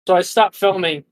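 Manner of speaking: usual speaking voice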